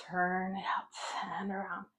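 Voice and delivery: slow breathy whisper. Shown as a demonstration. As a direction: whisper it slowly, no voice. Repeating the cue whispered.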